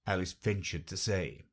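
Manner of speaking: ordinary delivery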